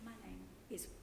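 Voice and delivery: hoarse, barely voiced; raspy whisper. Real, not acted. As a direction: voice almost gone. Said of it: "I'm losing my voice".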